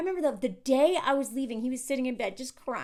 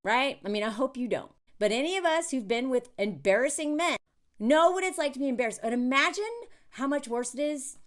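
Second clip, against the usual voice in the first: normal talking voice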